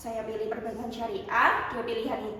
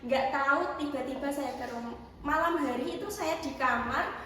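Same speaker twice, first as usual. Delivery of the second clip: normal talking voice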